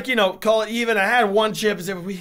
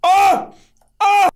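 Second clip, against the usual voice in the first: short, high, loud "Ah!" bursts; sharp cries from burning mouth; pained yelps; 10 semitones higher, 5 dB louder than usual. Real, not acted. Eater reacts to the burning chip, yelping.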